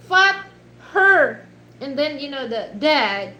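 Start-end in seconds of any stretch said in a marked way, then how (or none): 0.1-1.5 loud, high, separated words; dad mispronouncing. Retold or imitated. Imitating dad who doesn't get it, loud sounding out. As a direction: blurt each word out loudly. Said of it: "he pronounced it".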